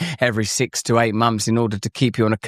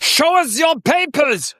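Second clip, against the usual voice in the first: put-on high, slow barking German officer accent; war movie german accent; 3 dB louder, slower than usual. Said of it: "Why are you doing a Nazi accent?".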